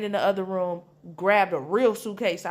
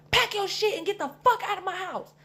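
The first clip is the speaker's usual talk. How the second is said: high, angry mock mom; a higher mock mother ordering her out of the house; mom kicking her out; 11 semitones higher than usual. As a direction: play the mother throwing her out. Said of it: "She was like".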